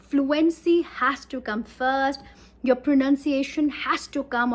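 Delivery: each word drawn out slowly, stressed; slow teacher's emphasis; slow emphatic rule. Shown as a demonstration.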